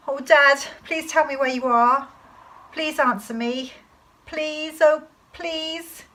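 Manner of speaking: high, desperate plea to a missing father; trembly pleading. Acted. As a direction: beg in a trembly voice, loud at first, then fading. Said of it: "my voice began to go all trembly".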